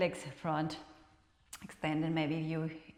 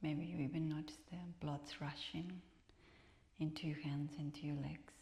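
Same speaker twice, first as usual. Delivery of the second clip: soft whispered meditation voice; guided relaxation; 9 dB quieter than usual. Acted. Teacher guides students in final rest, whispered. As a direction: ease them into stillness.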